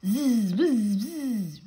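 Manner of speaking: high, loud peevish buzz; peevish squeak. Acted. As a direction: buzz back in a cross little squeak. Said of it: "in a peevish squeak".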